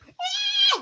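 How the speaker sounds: very high-pitched thin scream; imitated scream. Mom Brain as bird flies at windshield, screaming. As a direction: shriek like a panicking driver, held back in volume. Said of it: "like, screaming".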